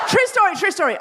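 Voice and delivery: high, loud cry of true story, true story; protesting to a laughing crowd that it really happened; emphatic insistence. Real, not acted. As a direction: shout it out so they believe you. Insisting to the audience it's real.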